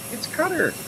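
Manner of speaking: Qatar said like "cutter", very high; high-pitched pipe-up, Qatar as "cutter"; mock correction. Host butting in on own reading, high mock correction.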